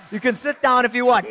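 usual speaking voice

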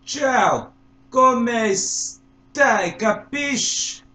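loud, high, hammed-up Italian greeting, drawn out at the end; Italian accent impression. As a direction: shout it like an over-the-top Italian in Grease. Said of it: "So here it goes".

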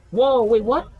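very high, loud startled whoa; shocked shout. Real, not acted. Gamer spotting level six six six, loud shocked cry. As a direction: jump out of your seat at it.

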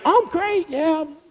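high, forced cheerful church-face answer; pretending all is fine in a high chirpy voice; fake cheerful. Worshipper faking cheer to a greeter, high forced cheerful.

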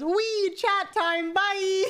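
squeaky high, giddy mock voice; imitating Bean. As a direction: play the daughter gleefully running off to chat. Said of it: "And she goes".

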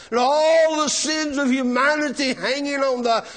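loud, high, impassioned sermon cry; full-force preaching. Real, not acted.

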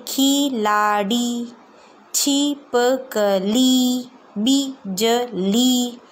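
खिलाड़ी, छिपकली, बिजली spelled out syllable by syllable, very slow; slow syllable drill. Shown as a demonstration. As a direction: stretch each word out for children to copy. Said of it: "ये देखिए".

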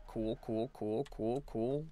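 flat quiet cool cool; deadpan. Real, not acted. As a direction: mutter it, unimpressed. Deadpan reaction to chat.

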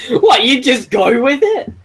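high, slow words through laughter; laughing. Real, not acted. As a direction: talk while cracking up. Laughing mid-sentence.

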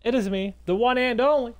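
loud, lifted host greeting; big punched-up YouTube opener; showy video intro. Real, not acted. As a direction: announce yourself to the viewers with a flourish.

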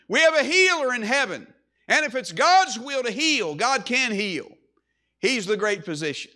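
preacher lifting the voice in proclamation; emphatic preaching. Acted. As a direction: proclaim it with conviction to the congregation. Proclaims God as healer.

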